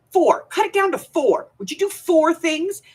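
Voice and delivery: high, pleading imitation of him; hammed-up husband haggling her down to four; mock bargaining husband. Husband bargains with his wife over her tasks, mock pleading.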